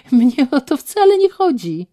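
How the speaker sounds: loud, high, laughing retort; loud scoffing laugh; snorting laugh. Acted. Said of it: "parsknęła śmiechem".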